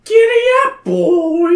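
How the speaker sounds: very high, loud giddy up; shouting. Acted. You and Patty shouting at the horse. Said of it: "you and Patty shout".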